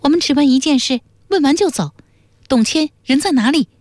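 high-pitched, firm character voice; high and businesslike; determined young questioner. A narrator's reading. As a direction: demand an answer in a high, level voice.

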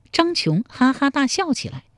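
usual speaking voice

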